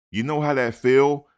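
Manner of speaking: usual speaking voice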